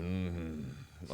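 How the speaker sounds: low, very quiet dismissive grunt; wordless grunt. Retold or imitated. Rival fighter snubs a greeting, low dismissive grunt. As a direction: imitate a silent opponent's soft low "mm-hmm". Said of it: "doesn't say a word. He's like".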